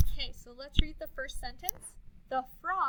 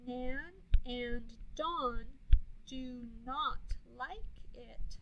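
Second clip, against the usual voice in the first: slow choral reading; much slower than usual. Shown as a demonstration. Choral reading, stretched slowly.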